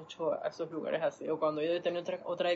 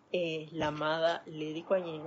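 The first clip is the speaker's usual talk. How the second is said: usual speaking voice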